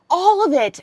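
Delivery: normal talking voice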